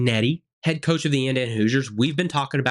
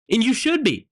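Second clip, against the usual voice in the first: rising outrage; 8 semitones higher than usual. Real, not acted. Ranting about fans' fury.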